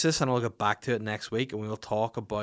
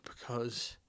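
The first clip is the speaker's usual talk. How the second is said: softer, whispery mock-heartbroken plea; hammed-up tearful self, much softer; mock sobbing; 7 dB quieter than usual. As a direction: whine it like a teary husband. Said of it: "I was just like".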